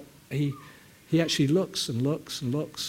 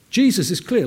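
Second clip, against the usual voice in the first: usual speaking voice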